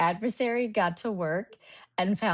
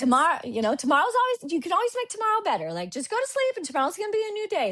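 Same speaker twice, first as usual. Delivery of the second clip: high-pitched voice of a comforting mom; reassuring mom; 8 semitones higher, faster than usual. Retold or imitated. Daughter quoting mom's advice about tomorrow, high reassuring mom voice. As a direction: imitate mom's quick, high pep talk. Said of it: "that's what my mom would always say".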